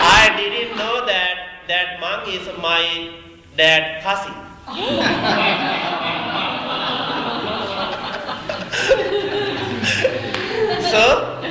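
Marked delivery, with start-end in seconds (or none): 4.4-10.8 laughing hard and high; long giggling fit. Real, not acted. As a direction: let the laugh run long. Laughing at his own story.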